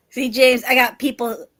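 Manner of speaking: talking with mouth full; words round a bite of food; chewing while talking. Real, not acted. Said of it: "Sorry if I'm chewing".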